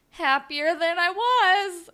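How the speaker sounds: hammed-up half-happy counselor; slow counselor voice. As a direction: draw it out like a tired counselor. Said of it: "he's like".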